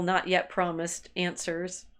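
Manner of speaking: ordinary talk, nothing marked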